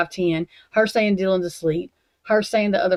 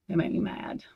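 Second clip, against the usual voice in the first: unvoiced mutter; 6 dB quieter than usual. Real, not acted. Angry rant, muttered aside.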